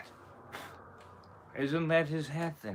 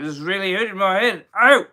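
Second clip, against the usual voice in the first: loud, high complaint building to a yelp; mounting pained yell; pained outburst; 8 semitones higher, 12 dB louder than usual. Acted. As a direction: cry out louder as it hurts, ending on a sharp ow. Thought voiced as a pained outburst.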